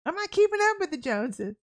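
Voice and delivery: high-pitched parental rebuke; mock scolding parent. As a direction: lay down the law like an exasperated parent. Parent scolding child in retold exchange.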